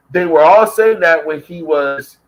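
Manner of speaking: usual speaking voice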